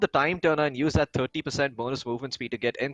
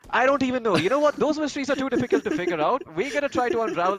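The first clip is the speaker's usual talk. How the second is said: excited; 10 semitones higher than usual. Real, not acted. Burst of mock exasperation.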